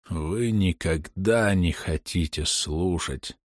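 a proud dying king lecturing; wounded king, weak and slow. A narrator's reading.

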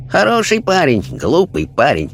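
high, calm sage voice; Chinese spirit voice. A narrator's reading. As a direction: sum him up gently as a wise spirit.

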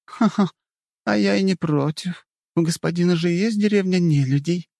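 put-on high playful girl's voice; playful elf girl. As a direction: laugh it off, cheeky. Elf answers laughing and playful.

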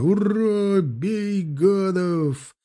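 high, very stretched charge shout; slow, high-pitched war yell; battle cry. A narrator's reading. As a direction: urge the troops on to attack. Dragoons charging with bayonets.

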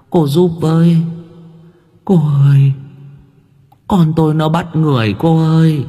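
distraught mother crying out for help; bà Côi pleading. A narrator's reading. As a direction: beg haltingly in short, stretched phrases.

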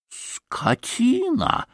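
high, drawn-out insult; theatrical yell held back in volume; outraged yell. A narrator's reading. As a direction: stretch the insult in high indignation. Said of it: "заорал было пан Врублевский".

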